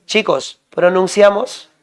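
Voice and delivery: ordinary delivery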